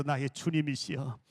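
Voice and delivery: low, slow, reverent greeting; 공경하는 인사. Shown as a demonstration. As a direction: greet them slowly as your lord. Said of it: "집사님을 향해서". (낮고 느리게 공경하는 인사)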